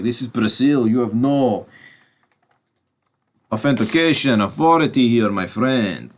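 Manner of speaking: light put-on Brazilian accent; hint of a Brazilian lilt, barely there. Rock arresting Dom, lightly put-on Brazilian accent. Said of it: "Don't know why I'm putting on an accent".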